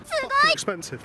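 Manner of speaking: sudden indignant squeal; outraged high burst. Real, not acted. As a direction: blurt the price outrage. Outraged at the price.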